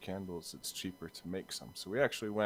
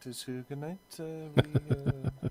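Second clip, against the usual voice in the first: put-on Scandinavian accent; 8 semitones higher, 8 dB louder, slower than usual. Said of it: "I'm not trying to do a Danish accent".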